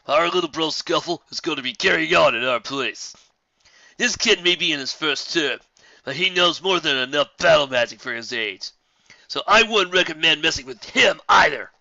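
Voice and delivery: loud, high swaggering bully voice; cocky bully. Acted. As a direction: boast like a schoolyard bully. Bully boasting to newcomers.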